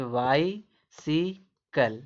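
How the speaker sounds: वाई, सी, कल as three slow separate beats; syllable by syllable. Shown as a demonstration. Teacher correcting the reading for learners, slowly syllable by syllable. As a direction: leave a clear gap after वाई and after सी. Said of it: "सी पढ़ेंगे".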